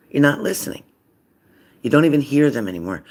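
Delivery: ordinary talk, nothing marked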